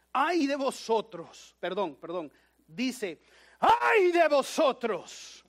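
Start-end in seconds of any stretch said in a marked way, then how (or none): 3.6-5.4 slow, high, loud cry of woe; shouted. Shown as a demonstration. Preacher shouting Jesus' words at the congregation. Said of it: "Ahí dice que uno tiene que gritar ahí".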